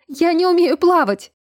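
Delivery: panicked high loud shout. A narrator's reading. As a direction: yell for help from the waves. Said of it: "выкрикнула Эльза".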